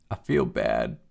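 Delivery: put-on low quiet sulky kid answer; sullen kid. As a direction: mutter it low like a sulking boy. Kid answers grandfather tersely.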